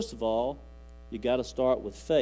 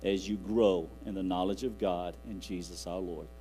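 scripture reading; 5 semitones lower than usual. Acted. Reads scripture aloud.

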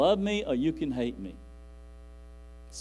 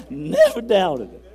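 laughing slower; 6 semitones higher, 11 dB louder, slower than usual. Real, not acted.

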